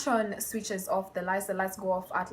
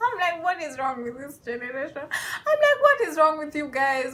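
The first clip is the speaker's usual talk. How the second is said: higher, laughing through the words; laughing disbelief; 5 semitones higher than usual. Real, not acted. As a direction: ask it while laughing.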